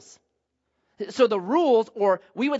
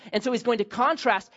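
usual speaking voice

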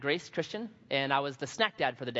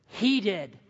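a hint of a small boy tattling; lightly voiced kid pointing the finger; boy blaming the snack dad, lightly childlike; little boy; 4 semitones higher, 4 dB louder than usual. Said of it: "this little boy looks at me and goes".

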